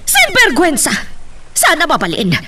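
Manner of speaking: high, indignant outburst at the shameless relatives; a mother exploding in outrage; outraged cry. Acted. Mother to daughter, outraged at the insult.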